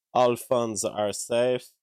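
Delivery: usual speaking voice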